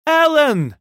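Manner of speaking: very high, loud desperate call; tearful shriek. A narrator's reading. Woman calling for her lost child, shrieking. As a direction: shriek the name, pitch falling away at the end. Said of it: "with tears in her voice".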